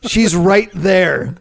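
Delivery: high, loud, drawn-out protest; embarrassed Adam. Acted. Adam warning God that Eve is there, loudly embarrassed. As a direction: stretch it out like a mortified teen. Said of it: "picturing Adam too being like".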